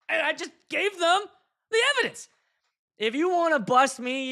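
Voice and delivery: high-pitched comic outburst; hammed-up high defiance; mock indignation. Comic defiance of imagined police.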